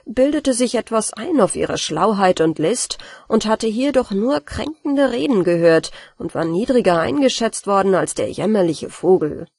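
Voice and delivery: ordinary delivery, nothing marked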